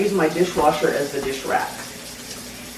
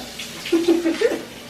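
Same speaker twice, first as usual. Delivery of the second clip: high breathy laugh; 13 semitones higher than usual. Real, not acted. Laughs after a joke.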